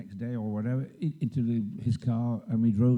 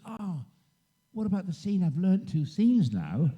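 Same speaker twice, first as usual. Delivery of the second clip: comic mock dismay; retold dismayed protest; 7 semitones higher than usual. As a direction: protest like all your effort was wasted. Retelling his protest on being surprised.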